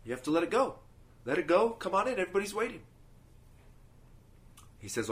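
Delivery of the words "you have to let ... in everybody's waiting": higher, lightly urging voice; Jesus coaxing. Acted. Jesus coaxes the man from Crete to come in, higher and coaxing. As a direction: coax him gently to let it go. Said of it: "Jesus looks at him and goes".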